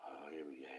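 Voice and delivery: breathy whisper under the breath; whispered side remark; whispered aside. Real, not acted. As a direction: mutter it to yourself, barely voiced. Muttered aside to self.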